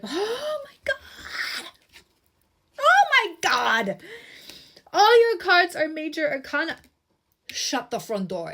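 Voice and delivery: can't believe the cards she drew; shocked excitement. Real, not acted. Shocked by all major arcana cards.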